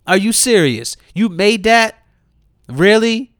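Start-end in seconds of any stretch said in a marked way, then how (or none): none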